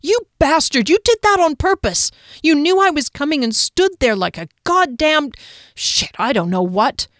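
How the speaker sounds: high, loud, outraged tirade; angry yelling. A narrator's reading. Startled woman berating the man who stood in her path, yelling. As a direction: lay into him, pitched high, losing steam at the very end. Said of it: "Kier yelled".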